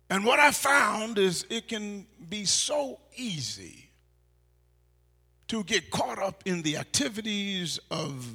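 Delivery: loud, rising pulpit preaching; preaching at full force. Acted. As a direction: preach it out to the whole church.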